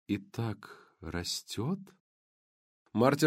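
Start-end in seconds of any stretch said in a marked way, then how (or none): 0.1-1.8 very slow. Acted. Slow soft far.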